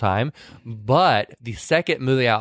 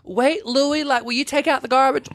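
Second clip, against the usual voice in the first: high southern lady call; Dolly voice; 12 semitones higher than usual. Retold or imitated. Dolly asking her husband to take out the garbage. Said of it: "She's like".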